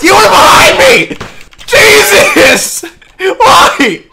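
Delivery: high, loud cry of outrage; yelling. Real, not acted. Gamer protesting a kill from behind, outraged yelling. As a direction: yell in shocked protest. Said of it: "Don't mean to yell".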